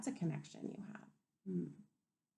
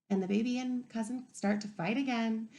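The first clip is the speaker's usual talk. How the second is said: plain reading voice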